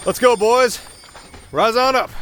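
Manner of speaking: high, loud call to the pack; rallying call. Acted. Calling his ice wolves up out of the snow, loud and high. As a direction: rouse the wolves like a proud handler.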